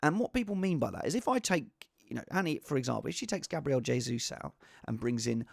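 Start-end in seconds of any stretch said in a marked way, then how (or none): none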